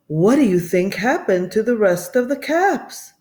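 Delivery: usual speaking voice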